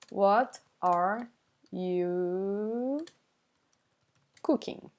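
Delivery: slow, drawn-out words with pauses. Shown as a demonstration. Teacher giving the meaning, slowly word by word. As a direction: stretch out YOU and pause around it.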